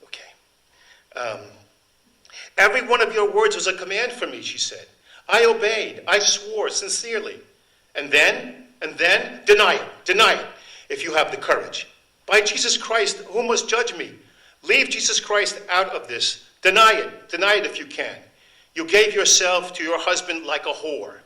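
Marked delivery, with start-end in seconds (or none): 2.6-4.4 high, loud, desperate plea to the Marquis; impassioned woman pleading. Acted. Accused woman confronting the Marquis, high loud anguish. Dramatic reading of novel quote. 5.3-21.2 high, loud, desperate plea to the Marquis; impassioned woman pleading. Acted. Accused woman confronting the Marquis, high loud anguish.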